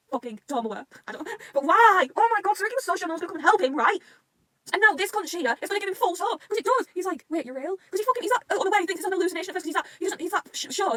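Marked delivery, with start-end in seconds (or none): 1.5-4.0 high, loud fan despair; exasperated outburst. Real, not acted. Fan lamenting Tomoe turning up, exasperated. Upset reaction to the plot. 4.7-10.9 worked-up rant. Real, not acted. Worked up over false hope.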